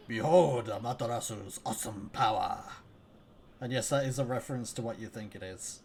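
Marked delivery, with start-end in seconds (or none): 0.1-2.6 epic announcer voice. Acted. Dramatic narrator line in game.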